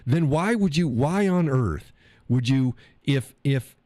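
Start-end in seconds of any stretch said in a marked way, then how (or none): none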